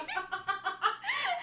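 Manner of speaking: high burst of laughter; high, loud real laughter; big laugh. Real, not acted. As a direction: crack up loudly.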